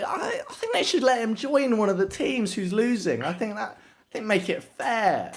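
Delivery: mock naive complaint. Joking about the referee.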